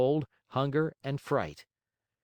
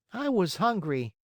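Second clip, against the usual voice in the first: put-on high puppet-boy voice; Pinocchio making excuses; 9 semitones higher than usual. A narrator's reading. Marionette answers the scolding glowworm, high and defensive. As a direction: plead your excuse in a high pitch.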